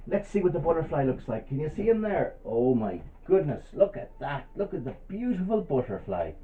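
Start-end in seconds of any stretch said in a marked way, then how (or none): none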